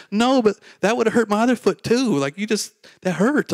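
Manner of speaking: high-pitched, pained complaint in retelling; imitated indignant protest. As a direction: protest like the wronged, kicked friend. Acts friend protesting the kick.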